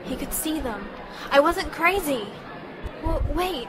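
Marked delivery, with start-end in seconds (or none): 1.3-3.7 elated realization. Acted. Sudden realization.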